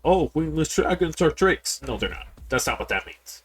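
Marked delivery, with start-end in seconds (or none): 0.0-1.8 mocking quote. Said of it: "So when you go".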